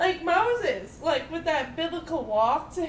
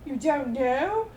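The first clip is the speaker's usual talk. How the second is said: lofty costumed wizard; 7 semitones higher, 7 dB louder than usual. Retold or imitated. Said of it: "And he's like".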